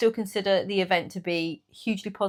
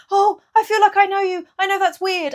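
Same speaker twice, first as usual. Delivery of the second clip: high, bright, gushing fan voice, much higher; excited fan; 12 semitones higher, 6 dB louder than usual. Retold or imitated. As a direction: gush like a delighted stranger. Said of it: "And she said".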